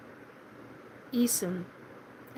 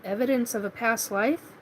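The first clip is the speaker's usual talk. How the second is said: usual speaking voice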